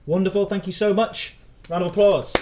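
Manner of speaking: loud, high-pitched thanks to the guest. Real, not acted. Host rousing applause for a departing guest, loud. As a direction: call for applause at high volume. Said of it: "Sorry if I'm being loud".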